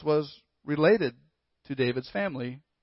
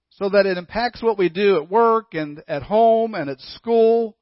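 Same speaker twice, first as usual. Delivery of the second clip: louder, rising sermon fervour; preaching; 4 semitones higher, 5 dB louder than usual. Real, not acted. Preacher urging the congregation to put Christ first, louder. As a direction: drive the point home to the congregation.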